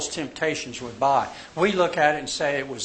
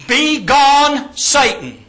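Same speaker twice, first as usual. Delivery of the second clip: loud, high, drawn-out command; booming, punched dismissal; emphatic rebuke; 9 semitones higher, 8 dB louder, much slower than usual. Acted. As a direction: banish the enemy with full force. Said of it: "it's more emphatic".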